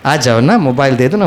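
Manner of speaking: low, drawn-out, sweet pleading; loving coaxing. Retold or imitated. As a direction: imitate a woman tenderly coaxing a monkey. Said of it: "बहनें बड़े प्यार से बोलते". (बड़े प्यार से मनाते हुए)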